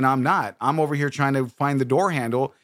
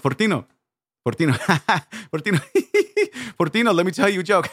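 giggly joke-teller breaking up between words; hammed-up laughing fits round each phrase; drunk sister giggling; 3 semitones higher, faster than usual. Older sister telling Fortino a joke on Christmas Eve, laughing. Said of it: "here's my sister telling, trying to tell a joke on Christmas Eve when everyone's drunk".

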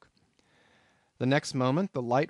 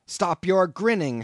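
much louder, higher, slow indignant yell; angry shout; 7 semitones higher, 8 dB louder than usual. A narrator's reading. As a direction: bark it at the grinning landlord. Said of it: "shouted I".

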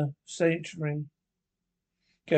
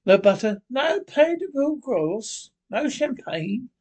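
higher, slow, teasing list with a grin; grinning mock lament; 8 semitones higher, 4 dB louder, slower than usual. A narrator's reading. Rat teasing Mole about missing luxuries, grinning. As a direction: savour each lost luxury jokingly. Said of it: "continued the Rat, grinning".

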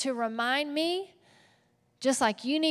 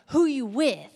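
a light gangster swagger on the title; mock gangster; 3 semitones higher, 4 dB louder than usual. Shown as a demonstration. Preacher trying out a gangster sermon title, lightly swaggered. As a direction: toss it off with a touch of street attitude. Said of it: "it sounded, like, really gangster".

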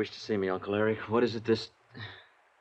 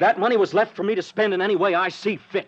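indignant retort; 8 semitones higher, 4 dB louder than usual. Acted. Nephew retorts to uncle.

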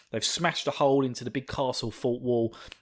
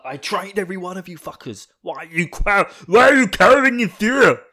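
barking commander, much higher and louder by the end; drill sergeant; 7 semitones higher, 9 dB louder than usual. Retold or imitated. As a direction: yell at the cowering troops. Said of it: "He's just like".

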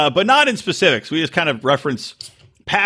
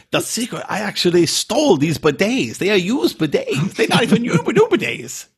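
mock Jehoon; 5 semitones higher than usual. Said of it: "And then Jehoon's like".

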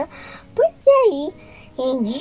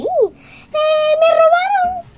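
Pinocho's voice; 5 dB louder, slower than usual. Acted. Pinocho answering the fairy.

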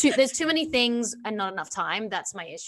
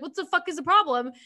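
mild mock outburst; 7 semitones higher, 4 dB louder than usual. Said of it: "she was like".

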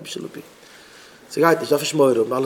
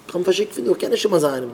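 ordinary delivery

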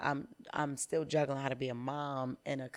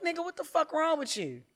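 higher, louder exasperated rant; retold furious yell; 8 semitones higher, 7 dB louder than usual. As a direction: blast the coach for not getting it. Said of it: "that shit make me so mad".

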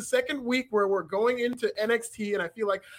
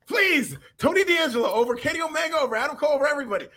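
mock campaign plea; 5 semitones higher than usual. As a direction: sell it like a campaign ad.

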